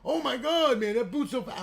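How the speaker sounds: amazed customer. Retold or imitated. Imitating an amazed customer watching the console boot, high and loud. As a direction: squeal it like a customer stunned by the speed. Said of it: "going, like".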